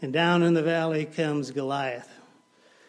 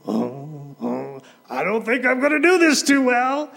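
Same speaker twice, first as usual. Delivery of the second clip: put-on nervous teenage [name]; 3 semitones higher, 9 dB louder than usual. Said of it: "as a young teenager".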